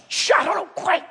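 high, sharp shushing; hammed-up frantic eavesdropper; acted urgent hushing. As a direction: shush your neighbour so you can overhear.

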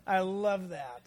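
usual speaking voice

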